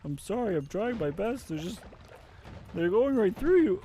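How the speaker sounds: high, pleading apologetic healer; whiny healer voice. Retold or imitated. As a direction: beg for forgiveness, voice straining up. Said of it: "Getting mad at the healer".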